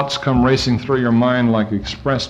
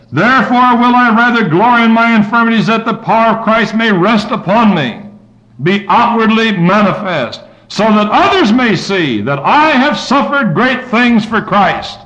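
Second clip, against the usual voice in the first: fervent loud reading; 8 semitones higher, 4 dB louder than usual. Acted. Rereading the scripture with force.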